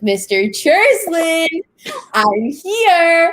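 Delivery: high, loud, slow sing-song call. Retold or imitated. Student calling to hiding math teacher, loud slow sing-song. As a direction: call him out from hiding teasingly.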